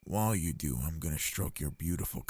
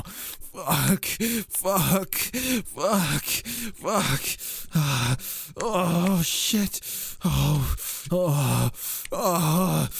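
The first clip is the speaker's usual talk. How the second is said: building to much higher, louder cries; climax cries; 11 semitones higher, 9 dB louder, much slower than usual. Acted. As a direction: let it break out at the peak. Reaching climax.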